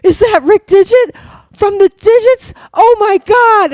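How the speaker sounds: big gasp, then high, loud squealed excitement; hammed-up fan freak-out; starstruck outburst. Kid seeing a musician sit down, loud starstruck squeal.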